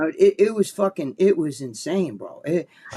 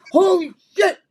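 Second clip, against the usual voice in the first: high, loud startled cry; startled exclamation; 13 semitones higher, 7 dB louder than usual. Retold or imitated. Sliding under in the hot tub, startled yell. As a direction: yelp it as you slip under.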